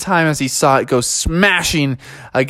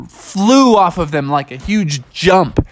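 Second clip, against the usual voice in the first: ordinary delivery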